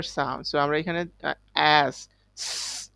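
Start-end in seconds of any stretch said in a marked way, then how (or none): 1.9-2.9 stretched, hissed "স" sound. Shown as a demonstration. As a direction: slowly hiss out "স" for the class. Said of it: "যেটার sound হচ্ছে কি?". ("স" ধ্বনিটা টেনে হিস করে)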